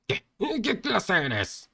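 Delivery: high, slow indignant demand; angry demand. Acted. Angry leader demanding the hero's class, indignant demand. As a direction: challenge him sharply.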